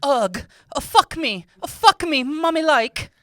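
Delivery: high, loud mock climax, building; hammed-up moaning. Acted sex scene, Renee climaxing.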